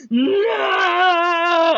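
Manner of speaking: long, high, loud mock wail; drawn-out theatrical scream; anguished villain shout. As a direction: cry out in hammed-up horror. Villain voiced as lover is killed.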